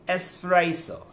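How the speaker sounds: "espresso" as "es-presso", S only; correct espresso. Shown as a demonstration. Teacher giving the correction, "espresso" pronounced with a clean S. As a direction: model "espresso" without any X sound. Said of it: "it shouldn't be X".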